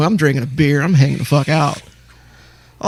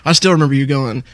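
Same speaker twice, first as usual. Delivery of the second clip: ordinary talk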